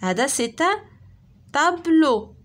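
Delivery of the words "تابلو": loud, clear model word tablo; loud teacher model of تابلو; word said out loud for class. Shown as a demonstration. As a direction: call out تابلو loud and clear for pupils to repeat. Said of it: "شنو كنشوفو هادي؟".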